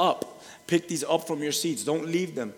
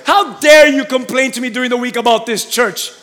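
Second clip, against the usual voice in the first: shouted prompt; 9 semitones higher, 13 dB louder than usual. Pretend. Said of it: "Look at that person next to you and say".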